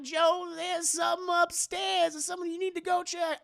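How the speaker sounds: high, whiny alarm; frightened pleading. Acted. Women asking Joe to check upstairs, high frightened whine. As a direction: beg in a high scared wail.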